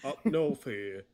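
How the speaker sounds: slow, put-on dumb Brit; dumb British accent. Shown as a demonstration. American shows friends their failed Northern accent, dumb British impression. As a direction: do a clumsy fake British accent. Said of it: "dumb British person impression".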